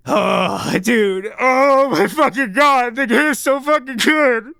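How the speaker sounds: real awe spilling over, slowly; overwhelmed excitement. Real, not acted. Gushing about the game's ending.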